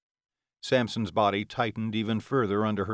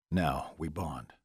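low male voice; 6 semitones lower, 4 dB quieter, slower than usual. Acted. Vampire lover speaks during bonding.

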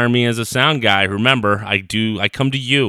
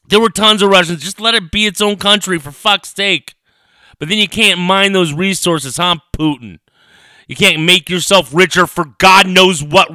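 loud, higher, heated ranting at Putin; angry rant; 5 semitones higher, 4 dB louder than usual. Real, not acted. Podcaster ranting at Putin over the Ukraine invasion, loud and furious. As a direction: vent real fury at a dictator.

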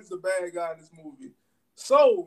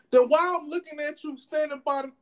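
put-on girlfriend confronting; 9 semitones higher than usual. Girlfriend calls him out in the parking lot, high and accusing. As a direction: mimic her catching him out, pitched up.

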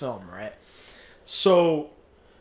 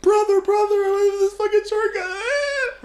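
crying wail, much higher and louder; hammed-up sobbing to his brother; mock crying; 6 dB louder than usual. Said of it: "he's crying".

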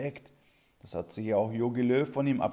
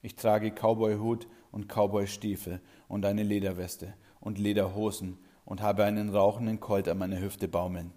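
ordinary talk, nothing marked

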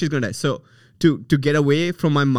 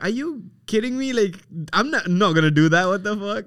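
higher-pitched, incredulous protest; retold disbelief; 7 semitones higher than usual. Son answering his mom about marrying a tree, incredulous. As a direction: act out a mildly indignant refusal. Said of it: "I was like".

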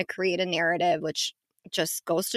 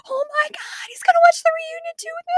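much higher, thrilled squeal; excited squeal. Retold or imitated. Wife thrilled husband will watch the reunion, squealing. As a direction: squeal like a giddy fan. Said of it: "I was, like, so excited".

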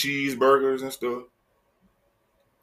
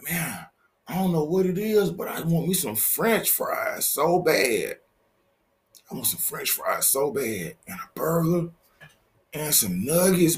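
playful pretend craving; mock craving; 7 semitones higher, 4 dB quieter than usual. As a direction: whine like the smell got you hooked. Craving fries from the candle.

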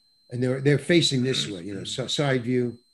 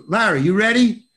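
retold director's call, higher and louder; 7 semitones higher, 7 dB louder than usual. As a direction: call across the set to check on him. Director calls to actor on set.

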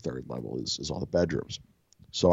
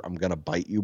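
normal talking voice

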